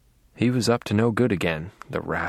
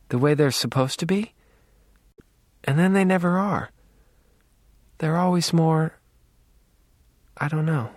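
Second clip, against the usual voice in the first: higher voice, halting, with long pauses; hesitant Rita; 6 semitones higher than usual. A narrator's reading. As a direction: grope for each phrase in a higher, uncertain voice. Narrator voices Rita searching for words.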